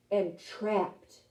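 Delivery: usual speaking voice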